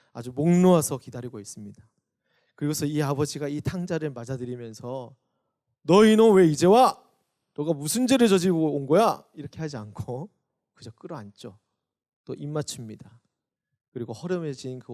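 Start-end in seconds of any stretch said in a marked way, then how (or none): 5.8-9.2 loud, high, furious rebuke of a son; angry scolding. Pretend. A father yells at the returning son, furious shout. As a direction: bark at the boy who finally came home.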